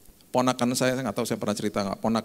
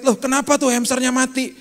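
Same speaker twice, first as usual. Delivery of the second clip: startled dad, pitched up and louder; father's surprise; 12 semitones higher, 5 dB louder than usual. Retold or imitated. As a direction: gasp it out like a shocked father. Father finds dead hamster. (ayah kaget, suara tinggi)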